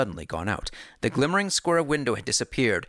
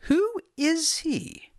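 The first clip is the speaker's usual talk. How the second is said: higher, slow question; Holmes voice; much slower than usual. A narrator's reading. Holmes asks.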